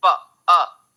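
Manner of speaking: "प" and "अ" each sounded alone, loud; isolated sounds. Shown as a demonstration. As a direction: punch out "प", pause, then "अ". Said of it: "अ sound आ रहा है".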